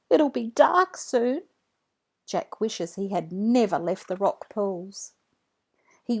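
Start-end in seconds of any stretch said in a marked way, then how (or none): 0.1-1.4 worried. Acted. Trapped child worrying.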